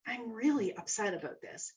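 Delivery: soft, measured talk to yourself; hushed, self-observing realisation; calm acknowledgement. Retold or imitated. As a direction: step back and quietly admit it. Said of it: "come up here and acknowledge".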